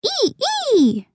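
two little high "ee" squeaks; spoken mouse-squeak imitation. A narrator's reading. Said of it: "Lainey squeaked".

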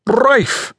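"Rife" high, with a rolled R; emphatic trilled R opening "Rife". A narrator's reading. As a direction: roll the R of "Rife" with relish. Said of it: "He rolled the R with particular emphasis".

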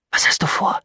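tense whisper; whispered question. Acted. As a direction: whisper it, demanding an answer. Tense question while holding the weapon. (angespannt geflüstert)

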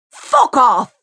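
loud snap back; furious retort. A narrator's reading. Mary swearing back at the neighbour. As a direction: tell him where to go.

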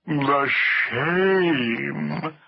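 long, drawn-out lament; ponderous, woeful elephant; mortified elephant. A narrator's reading. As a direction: groan it with wounded dignity. Hamlet laments.

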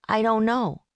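put-on high, reedy little boy; young boy voice. A narrator's reading. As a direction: pitch up into a small boy. Boy answers the prosecutor.

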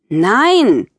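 put-on curt teenage snap; snapped no. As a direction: shut the begging down. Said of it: "schnappte Tiffany".

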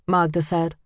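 usual speaking voice

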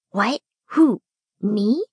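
halting, separate questioning words; a touch of flustered stammering; mild stammer. A narrator's reading. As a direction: break it into startled little questions. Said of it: "he stammered".

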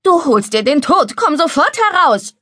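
high, scolding call; alarmed scolding, pitch climbing. Acted. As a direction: order him out from the bank. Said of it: "rief Leni".

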